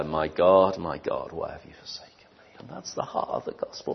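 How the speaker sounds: hushed whispered cry; awed whisper. Acted. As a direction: whisper it like a secret from the dark.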